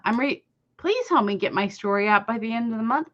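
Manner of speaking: normal talking voice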